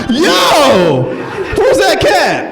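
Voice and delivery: high, urgent cry; hammed-up startled demand; alarmed on waking. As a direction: burst out in a high panic. Acted waking in alarm from dream.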